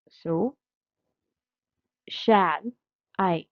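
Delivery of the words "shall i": "shall" and "I" set apart by a pause; soft start. Shown as a demonstration. Teacher scanning a sonnet's first foot, syllable by syllable. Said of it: "This is having a soft start".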